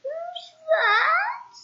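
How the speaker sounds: high, squeaky, sad little voice. Acted. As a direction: squeak it out like a gloomy little man. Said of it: "a sad, squeaky sort of a voice".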